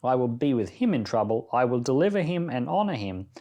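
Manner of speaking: usual speaking voice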